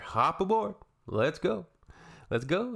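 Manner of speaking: high, chirpy call to hop on; playful boarding call. Pretend. Polar bear driver to schoolkids, playful boarding call.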